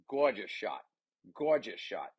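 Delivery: normal talking voice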